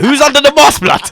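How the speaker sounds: high, loud mock demand; excited onlookers. Onlookers asking who is under his mask, excited. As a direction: imitate nosy fans calling out.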